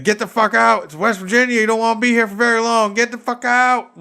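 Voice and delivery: mock West Virginia road voice. Voicing West Virginia to drivers, a high loud mock shout. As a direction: bellow it like the state itself chasing you out.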